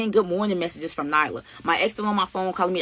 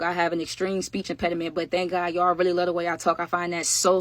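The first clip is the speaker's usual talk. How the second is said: usual speaking voice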